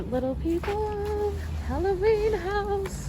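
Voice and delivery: playful high spooky voice; a spooky Halloween announcer, played for fun. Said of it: "That's a weird voice".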